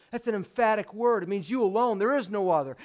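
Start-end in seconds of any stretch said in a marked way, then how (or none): none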